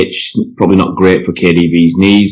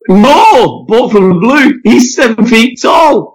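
much higher, loud, awestruck yelling; awed shout; 3 dB louder, slower than usual. Acted. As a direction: cry out like a terrified villager. Crowd member's awed outcry in parody.

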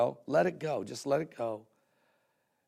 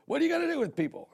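much higher, fast, fed-up question; exasperated old man; 12 semitones higher, faster than usual. Retold or imitated. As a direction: toss it off like a grumpy old man. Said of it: "looked at me and says".

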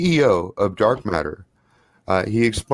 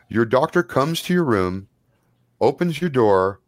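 ordinary talk

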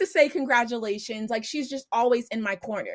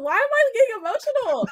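getting emotional; 12 semitones higher, 6 dB louder than usual. Real, not acted. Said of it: "I don't wanna get emotional".

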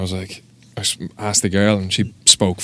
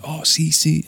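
higher-pitched imitation of a foreign tattooist; imitated tattooist; 8 semitones higher, 4 dB quieter, slower than usual. Tattooist with little English getting the flag, high imitated voice. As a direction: mimic her eager understanding.

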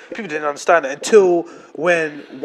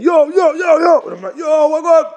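higher, louder yo calls; calling out; 10 semitones higher, 4 dB louder than usual. Retold or imitated. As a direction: call out across the yard. Retold shouts from crowd.

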